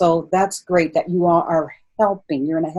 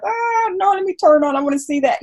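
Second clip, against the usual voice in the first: mock excuse; 13 semitones higher than usual.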